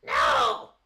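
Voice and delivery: screaming very high and loud; near-crying squeal of protest; shrieking demon king. Acted.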